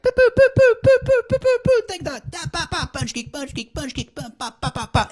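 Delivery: put-on high, loud kid shooting noises; a boy making fight sounds for his toys; toy gun sound effects.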